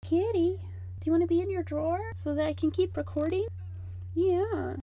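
high, soft pet talk; cooing to a cat. Real, not acted. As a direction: coo gently to a kitty. Talking to her cat.

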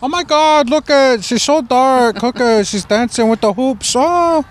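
high, hammed-up Russian accent; bad Russian accent. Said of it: "I kinda made Russian sound like Kevin".

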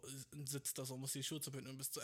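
normal talking voice

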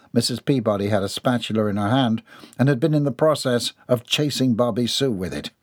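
ordinary delivery

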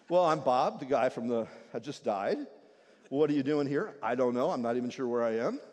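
low, bewildered replies; lost, unsure of anything; confused thief. Acted. Confused thief answers angel.

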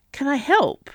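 urgent plea to be allowed to help. A narrator's reading. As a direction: press the offer urgently. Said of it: "he asked urgently".

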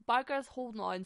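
ordinary talk

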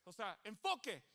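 usual speaking voice

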